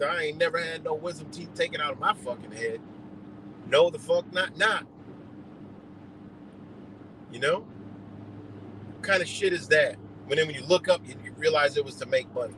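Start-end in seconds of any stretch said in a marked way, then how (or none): none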